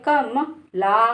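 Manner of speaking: "कम ला" slowly, with म said softly and short. Shown as a demonstration. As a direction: say "कम ला" gently, barely touching म. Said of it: "माँ को धीरे से बोलना है". ("कम ला" में म को धीरे से, धीमे बोलें)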